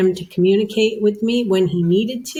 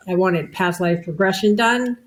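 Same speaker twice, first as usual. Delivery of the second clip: ordinary delivery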